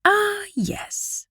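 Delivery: breathy high whispered voice of Wit; Wit's whisper. A narrator's reading. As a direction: whisper it as the young pirate girl. Wit's voice in story.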